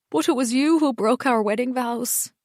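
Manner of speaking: ordinary delivery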